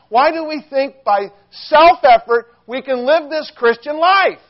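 loud, high and driving, pressing every line; preaching at full force. Acted. Preacher urging the congregation to surrender control, full force. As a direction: hammer it home to the congregation.

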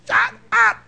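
high-pitched comic shout; mock yell. Woken sleeper yelling at chirping birds, mock shout. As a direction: squawk it like an annoyed sleeper.

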